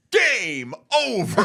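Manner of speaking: high, slow booming game over; announcer voice. Retold or imitated. Actor hearing his own game voice announce his defeat, booming announcer. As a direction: call it like the game's announcer. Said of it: "hearing me say to me".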